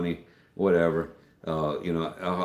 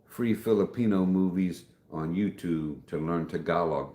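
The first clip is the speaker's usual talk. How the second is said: ordinary talk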